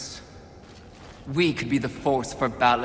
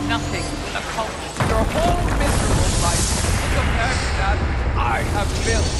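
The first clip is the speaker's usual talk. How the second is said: villain outburst; 6 semitones higher, 6 dB louder, faster than usual. Acted. Villain rages at the Horseman.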